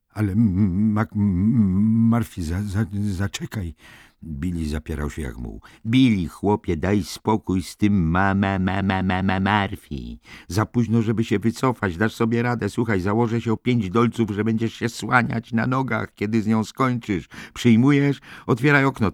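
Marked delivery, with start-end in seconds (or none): 0.1-3.7 nerwowe jąkanie, wyższym głosem. Acted. Billy stammers in protest. 5.9-19.1 wyższy, natarczywy głos zachęty; kpiące namawianie. Acted. McMurphy mocks and goads Billy.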